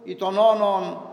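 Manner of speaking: slow, measured liturgical intoning of scripture; Gospel reading. Acted. Priest reading the Gospel to the congregation, slow liturgical intoning. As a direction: intone the Gospel slowly, as at the altar.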